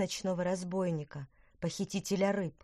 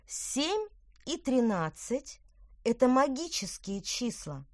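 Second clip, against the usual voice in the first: mysterious; 6 semitones higher, slower than usual. Acted. Petronella, mysterious.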